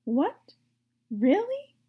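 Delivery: very high hopeful cry; surprised cry. Acted. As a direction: gasp it in disbelief. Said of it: "cried Wilbur".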